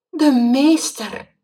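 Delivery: slow, high, loud stunned repeat; shocked again. Acted. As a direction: still horrified, say it again.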